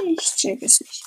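breathy whispered confusion; whispering. Real, not acted. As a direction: whisper it, baffled. (geflüstert)

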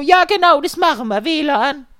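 high, loud customer voice; eager customer. Retold or imitated. Customer agreeing with the technician, high mock voice.

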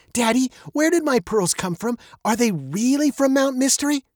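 put-on high-pitched little girl's question; young girl. A narrator's reading. Veronica asks her father about her pearls, childlike. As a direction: ask Daddy as a curious child.

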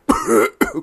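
high, short theatrical cough; staged throat-clearing cough; put-on cough. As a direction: cough it out as written in the letter. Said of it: "기침을 왜 하지".

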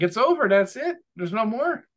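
high, whiny mock disappointment; mock dismay. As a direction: pretend the fun is cruelly over. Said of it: "I'm like".

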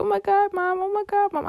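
high, half-acted panicked cry to Mom; mock-panicked wail, pitched high; retold panic. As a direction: replay the moment of horror, voice shooting up. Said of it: "And I started panicking".

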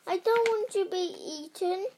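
high, tearful plea, mildly acted; a touch of a sob, pitched high; sobbing cry. A narrator's reading. Frightened Tessa pleading not to be eaten, mock sobbing. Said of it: "began to sob".

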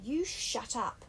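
slow, cold and hostile; Jack snapping. A narrator's reading. Jack turns on Piggy, a slow hostile threat. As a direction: shut him down with contempt. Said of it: "Jack turned on him".

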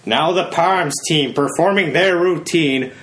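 drawn-out PA announcement, rising to a high cheer; middle-aged stadium announcer. Retold or imitated. Middle-aged guy announcing the Palms team, hammed-up announcer. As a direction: introduce the team slowly, then pitch up to urge them on. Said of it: "always some middle-aged guy".

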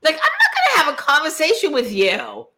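squeaky outraged disbelief; high indignant mock. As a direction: play it as shocked, appalled refusal. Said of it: "And I'm like".

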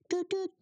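high mock train whistle doot doot; train horn toot. Imitates the Tramer train horn.

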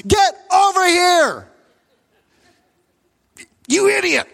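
loud, high, drawn-out furious shout; top-of-lungs yell. Retold or imitated. Freaked-out father yelling for help in hospital, angry. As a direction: re-enact a full-lunged angry yell. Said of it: "I yelled at the top of my lungs".

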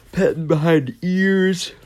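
put-on voice for cuddling dogs; silly doggy-talk voice; pet-owner baby talk. Shown as a demonstration. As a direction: coo it as if petting a dog. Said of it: "talking like this".

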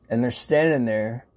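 ordinary talk

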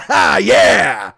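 loud, punchy cheer; real excited yell; excited whoop. Real, not acted. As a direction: whoop in triumph. Whoops at his own punchline.

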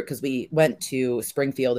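usual speaking voice

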